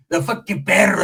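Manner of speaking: ordinary talk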